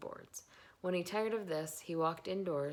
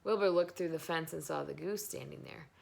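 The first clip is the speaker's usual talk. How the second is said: plain reading voice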